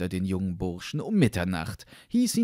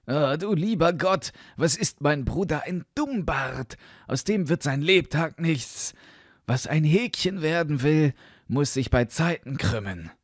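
lightly voiced scornful brother, a little higher; a touch of exasperated disdain; scornful elder brother; 6 semitones higher than usual. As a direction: shake your head over a hopeless brother.

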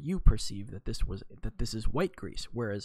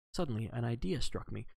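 usual speaking voice

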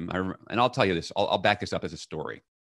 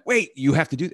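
stern father; 8 semitones higher, 4 dB louder than usual. Retold or imitated. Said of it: "this demon in her life who went".

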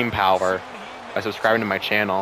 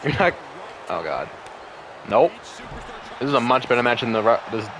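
normal talking voice

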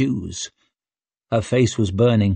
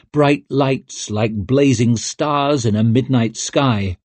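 usual speaking voice

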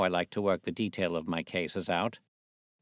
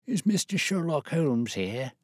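put-on old man voice; 8 semitones higher, 4 dB louder than usual. Disguised old man speaking.

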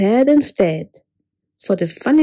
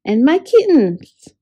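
higher, louder anxious call; worried cat; 4 semitones higher, 6 dB louder than usual. Acted. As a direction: cry out for the kittens. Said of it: "asked Cat".

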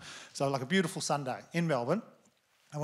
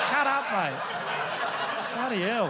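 laughing heckle; 8 semitones higher, 6 dB louder, much slower than usual. Retold or imitated. Heckling friend mid-laugh.

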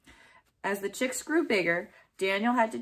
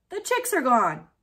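louder call over the shoulder; calling back; 6 semitones higher, 6 dB louder than usual. A narrator's reading. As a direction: call back while running off. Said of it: "called back over his shoulder".

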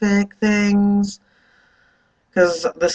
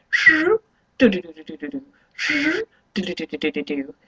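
bird call imitation. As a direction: mimic the annoying bird chirping outside. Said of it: "it's like".

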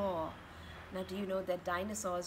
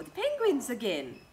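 plain reading voice

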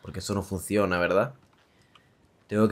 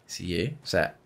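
ordinary delivery

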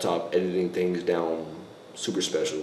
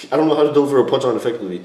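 fast and louder, tumbling out; rushed repeat; 5 semitones higher, 7 dB louder, much faster than usual. Shown as a demonstration. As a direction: blurt it out quickly, a touch exasperated. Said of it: "the tone of my voice".

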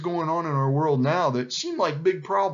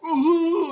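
loud, high howl; lion's howl; 7 dB louder than usual. A narrator's reading. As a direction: howl out one long vowel. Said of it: "howled the lion".

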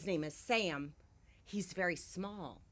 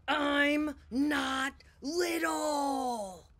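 much higher and louder, very slow and drawn out; shouted protest; 10 semitones higher, 7 dB louder, much slower than usual. Acted. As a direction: bellow each word. Climactic shouted refrain.

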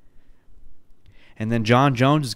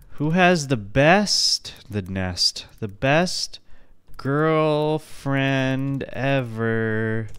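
slowly typing aloud; much slower than usual. Real, not acted. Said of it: "I guess I have to type it".

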